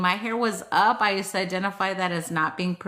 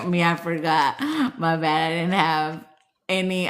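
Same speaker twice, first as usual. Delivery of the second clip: ordinary delivery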